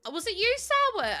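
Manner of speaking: single out a viewer playfully; excited question. Real, not acted. Asking a chat viewer if it was them, high excited call.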